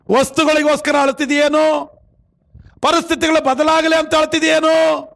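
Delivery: sustained high sermon cry, endings stretched; forceful preaching. Acted. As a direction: exhort the congregation point by point. Preaches to congregation. (ಏರುದನಿಯ ಪ್ರವಚನ)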